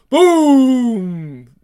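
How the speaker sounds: loud, drawn-out boom effect; explosion sound. Acted. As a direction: make a big boom. Voiced explosion.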